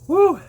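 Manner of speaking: high, loud whoop at the burn; pained whoop. Real, not acted. Vlogger reacting to the pepper's heat, high loud whoop. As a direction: let the heat burst out as a whoop.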